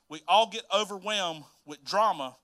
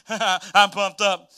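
slow, louder pumped-up drawl; 8 dB louder, much slower than usual. Retold or imitated. Joseph hearing Mary wants to talk, slow and pumped. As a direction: stretch it out, hyped and eager. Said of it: "I'm sure he was excited".

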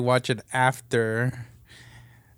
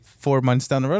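ordinary delivery

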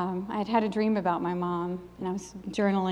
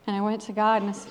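usual speaking voice

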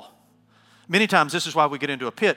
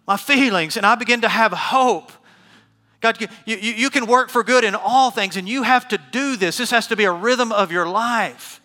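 loud, high pulpit declaration; full-force preaching; 5 semitones higher, 5 dB louder than usual. Acted. Preacher turning the verse into prayer, full-force preaching. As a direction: proclaim the promise back to God.

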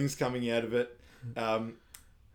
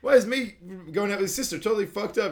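dawning outrage; 9 semitones higher, 6 dB louder, faster than usual. Retold or imitated. Slow realization of insult.

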